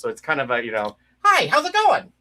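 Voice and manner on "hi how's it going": much higher, louder cartoon greeting; puppet character voice. Shown as a demonstration. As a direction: chirp it out like a cheerful puppet. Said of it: "That's the voice I use for it now".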